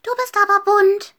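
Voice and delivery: high-pitched shout from a tiny mouse; loud field mouse call. A narrator's reading. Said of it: "rief eine Feldmaus, so laut sie konnte". (laut rufende Feldmaus)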